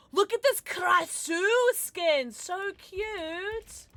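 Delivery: high, drawn-out cooing; gushing. Real, not acted. Streamer admiring a cute skin, high gushing. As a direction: coo over it high and slowly.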